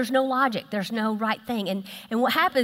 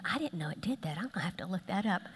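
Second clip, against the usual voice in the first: hushed, low aside as inner thought; very soft, low imagined thinking; private thought; 3 semitones lower, 9 dB quieter, faster than usual. Acted. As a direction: whisper it as if thinking to yourself. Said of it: "so many of you are thinking".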